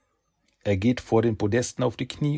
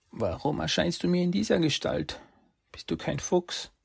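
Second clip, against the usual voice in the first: put-on higher, wondering boy voice; young boy; 10 semitones higher than usual. Saki questions the fox woman, high and wondering. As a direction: ask like a puzzled boy.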